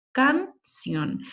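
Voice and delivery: "canción" broken as can, pause, ción; split into syllables. Shown as a demonstration. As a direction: keep the "io" of "canción" together in one beat. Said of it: "La I y la O están en la misma sílaba".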